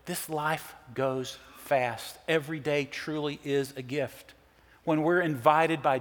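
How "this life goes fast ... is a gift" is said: soft and slow. Real, not acted. Grief over losses in family.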